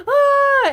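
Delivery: very high, loud shriek of victory; piercing happy scream; joyful scream. Retold or imitated. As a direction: let out a gleeful scream. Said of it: "I was, like, screaming".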